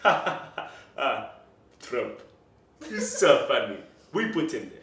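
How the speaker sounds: muddled mock Russian accent, lightly done; badly faked Russian accent, slipping elsewhere; attempted Russian accent. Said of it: "that's my African accent".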